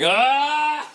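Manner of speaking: sharp high yelp, as if a bad memory stings; sudden yelp. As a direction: blurt out a pained high "Ah!". Shouting bad thoughts in shower.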